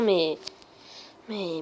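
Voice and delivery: ordinary talk, nothing marked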